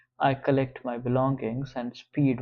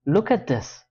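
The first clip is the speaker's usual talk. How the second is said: loud, raised demand; angry; 5 semitones higher, 4 dB louder than usual. Acted. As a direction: throw it at her. George flinging the pages.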